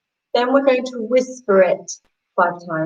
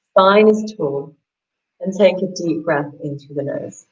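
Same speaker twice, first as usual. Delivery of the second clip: ordinary delivery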